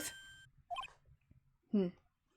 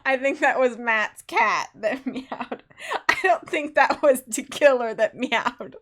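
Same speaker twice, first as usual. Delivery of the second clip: slow, loud, giggling through the words; can barely get it out for laughing; 2 semitones higher, 9 dB louder, slower than usual. Real, not acted. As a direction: player laughs at the assassin's meow, slow and loud. Player cracking up at meow.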